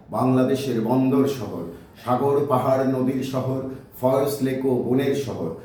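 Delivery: lifted, chant-like list poem; rhyme recitation. Acted. Chittagong native recites tribute to the city, rhythmic declamation. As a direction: declaim each line with a steady beat on the refrain.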